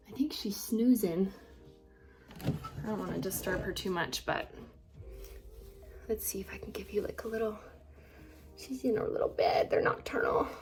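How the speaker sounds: hushed whisper near a sleeping animal; wildlife watching whisper. Real, not acted. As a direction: whisper so the pet stays asleep. Sneaking in on sleeping pet.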